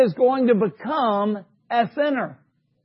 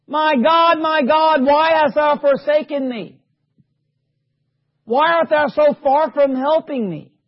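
raised, higher voice crying out in appeal; yelled cry to God; 4 semitones higher than usual. Acted. Preacher reading Jesus' words from the cross, crying out. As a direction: call out to God as one forsaken. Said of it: "He yells out to God".

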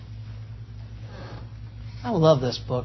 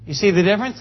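ordinary talk

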